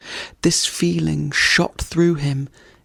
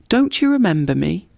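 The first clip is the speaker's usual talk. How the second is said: pleased; 4 semitones higher, faster than usual. Acted. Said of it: "putting on a pleased expression".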